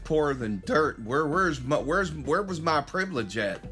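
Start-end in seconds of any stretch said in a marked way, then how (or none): none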